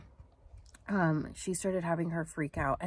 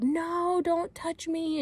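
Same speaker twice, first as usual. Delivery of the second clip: high-pitched mock cry of protest; imitated freak-out; 12 semitones higher, much slower than usual. Retelling a woman refusing to go to the barn, high panicked protest. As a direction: squeal it like someone resisting. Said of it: "And she was freaking out".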